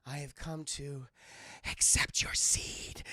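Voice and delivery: slow, low, soft sultry voice, trailing to a whisper; seductive Jade. Acted. Jade seducing Liu Kang, slow low sultry murmur. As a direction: purr it slowly and quietly.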